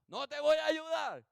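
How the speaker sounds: loud, high imitated refusal; acted refusal. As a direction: refuse loudly through the door. Amigo se niega a ayudar. (negativa imitada, fuerte y aguda)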